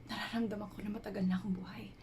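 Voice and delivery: soft, hushed introspection with long pauses; half-whispered, searching confession, left to hang between thoughts; reflective dramatic monologue. Acted. Woman reflecting on life's purpose while climbing a mountain at night, hushed monologue.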